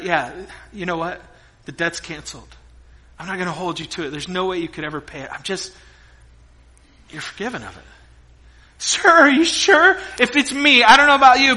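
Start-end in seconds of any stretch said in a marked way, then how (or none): 0.0-7.8 softer, lower, calm and kind; gentle king. Acted. King softly forgiving the kneeling servant, gentle. 8.8-10.0 higher, slow, incredulous; can't believe his luck; stunned servant. Acted. Forgiven servant asking the king in disbelief, incredulous.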